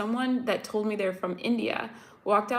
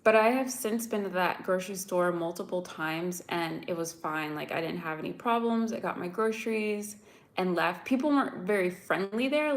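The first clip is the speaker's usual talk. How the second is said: normal talking voice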